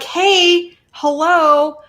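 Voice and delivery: calling dolphins. Acted. Card's dolphins greet the viewer, lightly voiced calling. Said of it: "these dolphins and this card are like".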